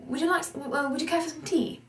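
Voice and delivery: British tea offer, a touch quick; British accent. Shown as a demonstration. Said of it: "In an American pronunciation".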